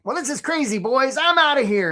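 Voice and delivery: high-pitched, annoying crab voice; a fed-up cartoon crab announcing his exit; silly high critter voice; Charlie the crab. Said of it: "Charlie's like".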